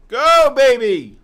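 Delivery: loud, slow, drawn-out cheer; roaring at the screen. Real, not acted. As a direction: egg the trade on like a fan at ringside. Cheering on a trader live.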